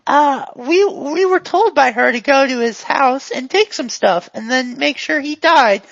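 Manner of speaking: high, eager storyteller; chatty, addled character. Acted.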